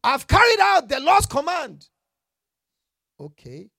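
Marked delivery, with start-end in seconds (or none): 0.0-1.9 shouted high and loud, boasting; mock proud shout. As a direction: shout it like a proud king claiming obedience. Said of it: "listen to what he said".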